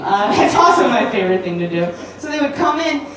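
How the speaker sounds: normal talking voice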